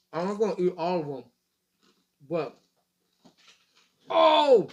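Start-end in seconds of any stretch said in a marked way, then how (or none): 4.1-4.7 sharp loud oh of surprise; surprised. Real, not acted. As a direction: blurt it out, startled. Sudden reaction mid-sentence.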